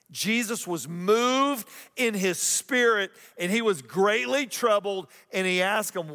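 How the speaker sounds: slow, high, swelling preacher read; dramatic scripture reading. Acted. Pastor reading the verse to the congregation, slow and solemn. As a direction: lean into every word of the verse.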